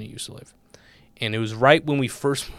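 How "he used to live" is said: hushed, voiceless aside; conspiratorial whisper about the rich town. Real, not acted. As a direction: drop to a secret whisper.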